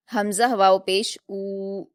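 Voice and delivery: ऊ stretched over two counts, slow and clear; teacher models the two-count u; drawn-out drill. Shown as a demonstration. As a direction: model the letter and vowel slowly for pupils. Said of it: "दो हरकात खींचेंगे".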